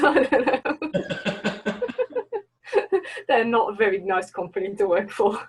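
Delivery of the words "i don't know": laughing. Real, not acted. Laughing at the company question.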